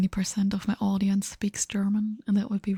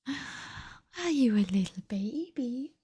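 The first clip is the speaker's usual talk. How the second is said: slow, drawn-out coo; 3 semitones higher, slower than usual. Real, not acted. Streamer asks her cat if it's baby, slow cooing. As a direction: coo it slowly, stretching the words.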